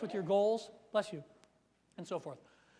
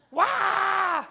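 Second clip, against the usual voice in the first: loud, higher mock wail; imitated kids' scream; 9 semitones higher, 8 dB louder than usual. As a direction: wail like squabbling little girls. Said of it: "my three girls are having a scream fest".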